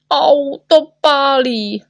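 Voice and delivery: high, slow, drawn-out whine; stretched-out acted cry of pain; pained wail. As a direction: wail as the sting burns. Iodine stings the patient.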